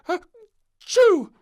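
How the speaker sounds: very loud, high sneeze; the king and queen sneeze loudly. A narrator's reading. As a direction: let the sneeze burst out. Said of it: "sneezed the king and queen".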